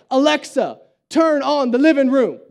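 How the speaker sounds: imitating a commanding toddler, firm and loud; authoritative. Three-year-old son telling Alexa what to do, authoritative. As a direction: bark it as an order, word by word. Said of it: "It's, like, all authoritative".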